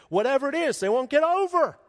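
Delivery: high, loud road-rage exasperation; exasperated outburst. Acted. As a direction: vent at drivers who won't move over. Frustrated rant about drivers.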